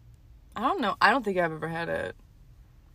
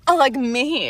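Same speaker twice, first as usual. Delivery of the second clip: lightly higher feminine voice; straight girl voice; 6 semitones higher, 8 dB louder, slower than usual. Pretend. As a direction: try on a girly tone. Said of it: "you just have to talk super feminine".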